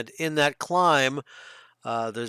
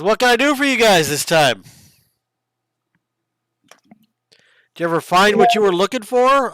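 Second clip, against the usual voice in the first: loud, higher-pitched chief greeting visitors; barbarian chief; 6 semitones higher, 8 dB louder, faster than usual. Acted. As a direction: play a hearty chief, projecting the questions. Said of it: "He says".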